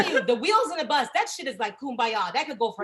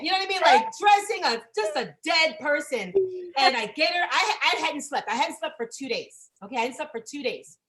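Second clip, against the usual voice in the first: usual speaking voice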